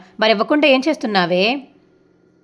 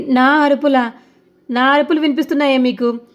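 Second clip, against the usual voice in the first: indignant outburst; 5 semitones higher than usual. Acted. Mother takes offence when told her yelling carries to the street, indignant. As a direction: bristle at the accusation.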